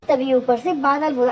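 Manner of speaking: ordinary delivery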